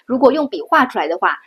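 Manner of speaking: normal talking voice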